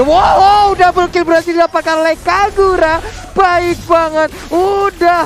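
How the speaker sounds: loud, high wow of amazement; excited shout. Real, not acted. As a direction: blow up at the double kill. Caster reacts to double kill.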